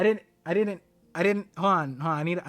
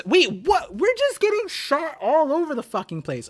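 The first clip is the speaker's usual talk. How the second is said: high, agitated disbelief; real high-pitched bewildered cry; startled outburst; 9 semitones higher, 5 dB louder than usual. Real, not acted. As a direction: blurt it out high in alarm. Startled by events while reading.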